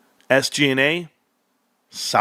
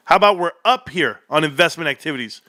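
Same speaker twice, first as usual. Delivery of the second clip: ordinary talk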